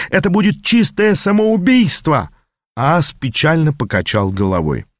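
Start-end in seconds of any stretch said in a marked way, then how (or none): 0.1-2.3 losing control. Acted. Apprentice protesting a suicidal mission, pitched up in alarm. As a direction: pitch jumps much higher in near panic. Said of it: "слегка теряя над собой контроль".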